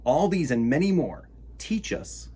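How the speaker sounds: put-on lively storytime read for kids; reading a picture book aloud. A narrator's reading. Host reading a word book to children, lively storytime voice.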